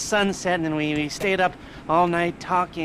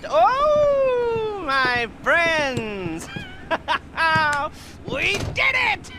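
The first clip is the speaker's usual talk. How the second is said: high, loud cries of joy with laughter; overjoyed cheer; 13 semitones higher, 3 dB louder, much slower than usual. Acted. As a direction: rejoice as if the win is overwhelming. Celebrating success with crew.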